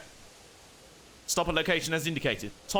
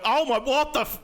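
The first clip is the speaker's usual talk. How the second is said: shocked exclamation; 7 semitones higher, 4 dB louder than usual. Retold or imitated. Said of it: "And you go".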